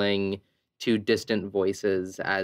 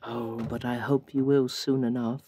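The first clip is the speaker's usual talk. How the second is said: dazed [name]; 3 semitones higher than usual. Acted. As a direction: drift through the words as if half somewhere else. [name] answers Avery.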